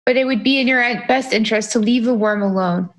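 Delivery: normal talking voice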